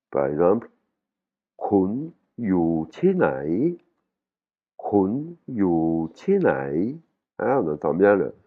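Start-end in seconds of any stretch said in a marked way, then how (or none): 1.6-7.0 "Kun yu chi nai" very slow, "chi" falling, "nai" dipping and swinging up; falling and broken tones. Shown as a demonstration. Teacher models a basic phrase, slow contoured tones on "Kun yu chi nai". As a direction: stretch each syllable, bending "nai" down then up. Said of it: "il a une intonation cassée".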